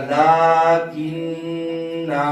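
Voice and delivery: लाकिन्ना chanted slowly, stopping on it; Quranic recitation. Shown as a demonstration. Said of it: "हमने वक्फ करना है".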